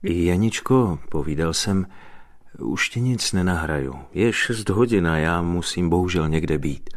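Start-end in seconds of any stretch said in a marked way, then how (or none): none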